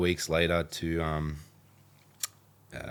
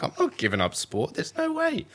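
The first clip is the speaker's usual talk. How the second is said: stubborn refusal, rising to a high no way; flat-out refusal; defiant. Retold or imitated. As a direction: dig in like a teen. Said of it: "I'm like".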